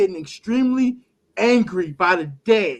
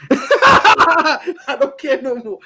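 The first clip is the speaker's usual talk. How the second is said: slow and loud through laughter; loud, drawn-out laughing resignation; laughing give-up; 6 semitones higher, 8 dB louder, much slower than usual. Real, not acted. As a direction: laugh it out, totally done. Laughing exasperation about Simmons.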